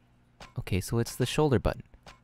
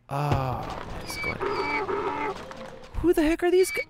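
alarmed; slower than usual. Real, not acted. Base under surprise attack.

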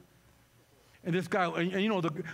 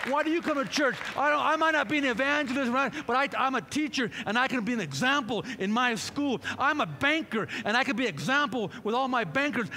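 louder, higher fervent preaching; a preacher building to a climax over music; preaching at full force; 4 semitones higher, 3 dB louder than usual. Acted. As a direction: drive it home to the congregation.

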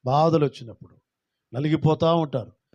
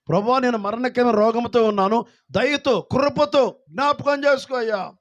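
pleading prayer; 8 semitones higher, 3 dB louder than usual. Acted. Hezekiah praying to God in illness, high pleading. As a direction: beg God for mercy.

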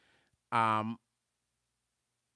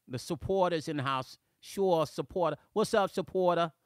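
playful, lightly hammed-up New Jersey accent; 5 semitones higher, 3 dB louder than usual. Shown as a demonstration. Host hailing a viewer in a mock New Jersey accent. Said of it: "here's my New Jersey accent".

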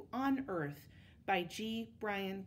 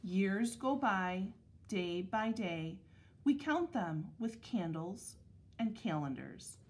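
measured picture-book reading with clear pauses; unhurried, carefully articulated storybook narration; read-aloud to children; slower than usual. Acted. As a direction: read it to second graders, phrase by phrase. Reads picture book to second graders.

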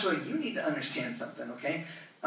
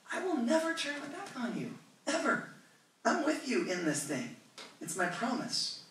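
God voiced high; 7 semitones higher, 5 dB quieter than usual. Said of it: "He goes".

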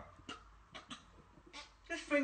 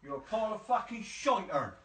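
usual speaking voice

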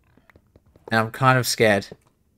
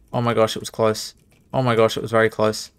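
ordinary talk, nothing marked

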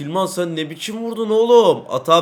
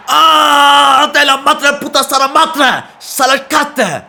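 high, loud raging cry at the team; furious shout; 11 semitones higher, 10 dB louder, slower than usual. Real, not acted. Gamer screaming at his useless defenders in fury.

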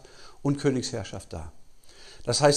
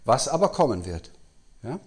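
ordinary delivery

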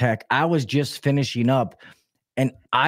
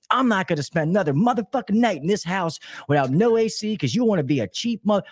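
raised-pitch mock tirade; wife going off; 7 semitones higher than usual. Wife to husband over a night without A C, mock going off. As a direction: imitate an irate wife, higher than your own voice. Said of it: "she was going off".

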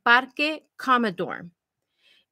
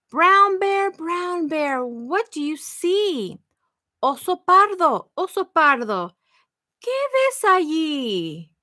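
bright, lilting read-aloud for little ones; sing-song the refrain to the kids; reading to children; 5 semitones higher, 3 dB louder than usual. Acted. Librarian reading the refrain to children, sing-song.

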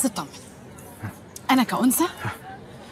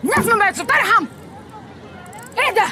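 outraged outburst; 11 semitones higher than usual. Acted. Angry outburst at him.

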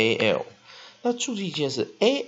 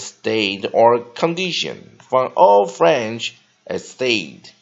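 slow, deliberate reading of a dictionary entry; careful reference-book read-through; 10 dB louder, much slower than usual. Shown as a demonstration. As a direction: read each phrase out slowly like a pronouncing dictionary. Reads a dictionary entry aloud.

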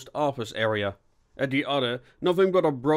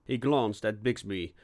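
plain reading voice

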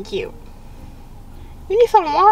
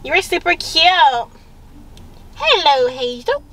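usual speaking voice

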